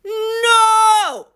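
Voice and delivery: high, long, held shout; all-out yell. A narrator's reading. Family refusing the cashier together, yelled. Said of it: "all yelled".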